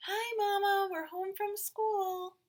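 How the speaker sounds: put-on high, loud children's greeting; calling out. A narrator's reading. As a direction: call it across the house on arriving. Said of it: "the twins called".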